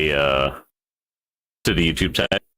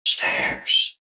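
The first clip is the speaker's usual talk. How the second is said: "Stares" breathed out as a spooky whispered echo; 3 dB quieter than usual. Pretend. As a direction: whisper "Stares" back to yourself.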